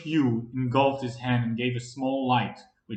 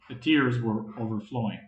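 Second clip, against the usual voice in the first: usual speaking voice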